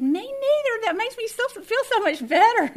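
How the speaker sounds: high, loud, giddy relief; loud laughing glee at the news; gushing high squeal. Retold or imitated. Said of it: "And I said".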